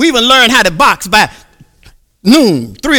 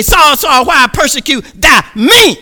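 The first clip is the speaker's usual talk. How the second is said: higher, louder heavenly call; a raised divine summons; God calling out; 5 semitones higher, 5 dB louder than usual. Acted. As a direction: call him out forcefully. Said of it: "He say".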